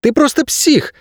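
very high-pitched angry outburst; acted flare of temper; angered. A narrator's reading. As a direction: lash out in a high voice without added volume. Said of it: "разозлилась она".

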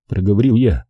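plain reading voice

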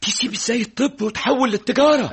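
high-pitched, agitated outcry; appalled disbelief. Acted. Friend protesting the switch from medicine, appalled. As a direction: challenge him in shocked protest.

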